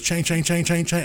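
high chiming chain sounds; imitated chime. Retelling the car story, high chime imitation. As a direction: mimic the car noise going off. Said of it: "It goes".

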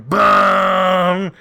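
loud high sung bong; musical sting. Retold or imitated. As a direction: belt it like a dramatic sting. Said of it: "a big musical sting going".

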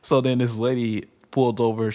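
ordinary talk, nothing marked

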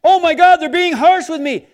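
loud, high mock alarm; mock overreaction. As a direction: overreact as if badly offended by a text. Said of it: "If you perceive a word to be harsh".

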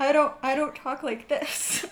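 laughing while protesting, slow. Real, not acted. Introvert friend laughing, denying she talks like that. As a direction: laugh it out in disbelief.

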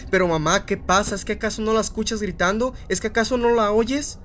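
put-on a frightened child begging his mother to believe him; desperate boy. (niño desesperado, cada vez más agudo)

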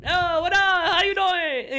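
high-pitched mock chef hello; hammed-up entertainer working the table; showy chef greeting. Acting out hibachi chef showing up.

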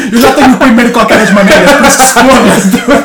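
high laughing reply. Real, not acted. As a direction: laugh through the confession. Laughing at fart question.

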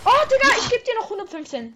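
high loud gaming yell; excited shout. Real, not acted. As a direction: yell out the hit in triumph. Excited hit in fight.

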